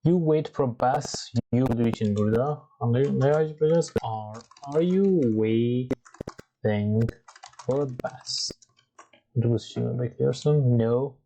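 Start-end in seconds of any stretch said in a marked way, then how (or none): 4.0-8.5 slow model question. Shown as a demonstration. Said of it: "teacher models question".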